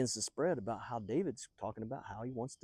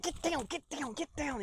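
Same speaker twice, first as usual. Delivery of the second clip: high, strained urgent warning; hammed-up panic, pitched way up; slower than usual. Soldier warns David to hide, urgently.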